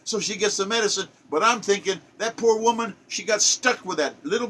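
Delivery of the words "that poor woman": ordinary talk, nothing marked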